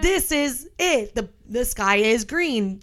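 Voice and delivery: slow, emphatic pronouncement; insistent Vernon. Retold or imitated. Co-host imagining a husband who won't be corrected, slow and insistent. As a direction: lay down a false fact slowly and stubbornly. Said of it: "if Vernon was like".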